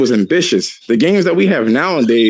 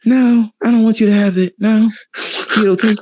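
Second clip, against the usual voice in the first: higher, pleading pop star impression; 6 semitones higher than usual. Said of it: "[name] was like".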